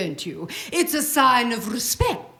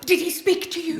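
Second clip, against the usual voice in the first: usual speaking voice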